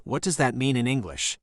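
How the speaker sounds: ordinary delivery, nothing marked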